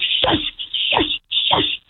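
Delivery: voiceless, repeated shushes; imitated harsh shushing. Mother imitating the woman pointing at her son, harsh shushing. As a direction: mimic someone sharply shushing a child. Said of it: "shouting and roaring and being like".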